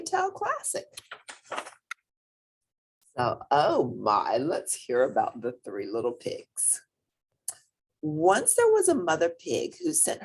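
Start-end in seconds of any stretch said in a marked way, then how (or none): none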